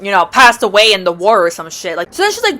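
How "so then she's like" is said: ordinary delivery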